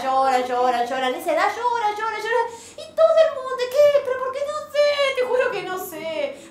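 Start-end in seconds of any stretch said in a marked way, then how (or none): 2.7-6.5 exasperated helplessness. Acted. Mother cornered by everyone's questions, very high and exasperated. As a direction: squeal it like a frazzled mom. Everyone asks, she's clueless.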